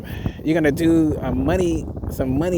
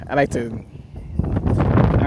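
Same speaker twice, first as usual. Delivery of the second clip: slow breathy whisper of I like to, I don't know; hushed, drawn-out whisper; 3 semitones lower, much slower than usual. Shown as a demonstration. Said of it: "repeats the line whispered".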